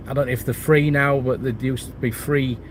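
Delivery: ordinary talk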